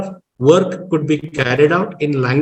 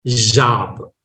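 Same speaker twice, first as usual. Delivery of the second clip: American "Job" with an open vowel, low pitch; American pronunciation; 5 semitones lower than usual. Shown as a demonstration. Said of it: "American is job".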